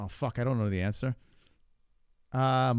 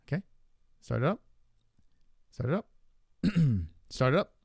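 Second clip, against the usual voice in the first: ordinary talk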